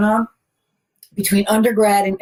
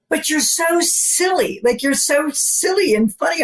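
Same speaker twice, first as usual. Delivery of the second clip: higher, drawn-out emphasis on how silly; mock disbelief, pitched up; playful retold protest; 7 semitones higher, slower than usual. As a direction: protest it high, stretching the key words.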